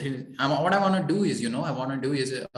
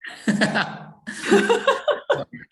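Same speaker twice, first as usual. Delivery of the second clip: laugh; 5 dB louder than usual. Real, not acted. Coach laughs at client's reply.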